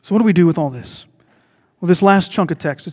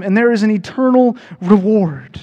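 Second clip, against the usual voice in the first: normal talking voice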